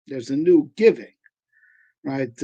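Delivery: ordinary talk, nothing marked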